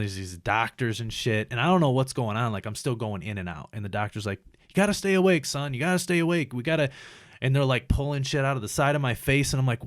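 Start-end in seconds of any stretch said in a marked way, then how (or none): 4.7-6.9 higher, insistent imitation of a doctor; urgent doctor. Doctor to patient drifting in and out, urgent retold plea. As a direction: urge a fading patient to hold on, voice raised.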